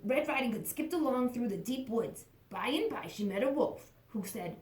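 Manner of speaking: plain reading voice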